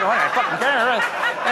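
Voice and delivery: mock tough guy laughing. Mock tough guy denying feelings.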